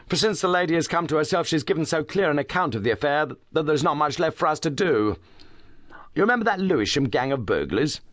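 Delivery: put-on eager young detective, talking fast; brisk young inspector. A narrator's reading. Inspector Hopkins voiced in story.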